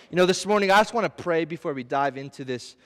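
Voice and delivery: ordinary delivery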